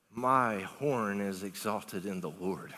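slow, low, soft scripture reading; slow reverent reading. Acted. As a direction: draw out each word slowly in a low voice. Reads Hannah's prayer, drawn out.

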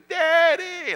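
put-on very high, excited little boy's call; child calling daddy. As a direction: squeal like a small son greeting his dad. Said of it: "he goes".

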